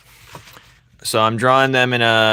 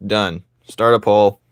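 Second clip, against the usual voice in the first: usual speaking voice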